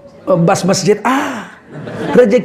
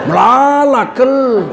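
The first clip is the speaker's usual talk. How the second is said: Karo exclamation; 5 semitones higher, slower than usual. Retold or imitated. Preacher imitating Karo speakers saying very many. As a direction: call out melala, kel in Karo style. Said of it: "kalau orang Karo pakai kel".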